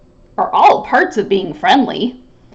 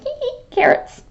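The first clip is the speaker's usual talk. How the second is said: a much higher, tittering bird voice; giggly bird; 13 semitones higher, 5 dB quieter, faster than usual. Acted. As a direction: chirp it out with a giggle.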